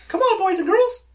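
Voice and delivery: very high squeaky cartoon mouse; Mickey Mouse voice. Shown as a demonstration. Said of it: "I can do Mickey Mouse".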